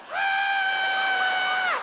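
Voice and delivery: very high sustained shriek; crowd's terrified scream. Acted. Crowd screams as snake jumps.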